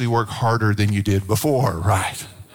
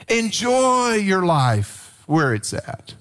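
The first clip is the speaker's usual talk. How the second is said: slow exhortation; 5 semitones higher, much slower than usual. Real, not acted. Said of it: "slow emphatic exhortation".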